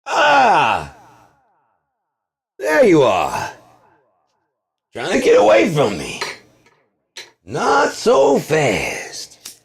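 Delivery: high, loud menacing villain catching prey. Acted. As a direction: pounce on them like a cartoon villain. Villain catching someone, acted.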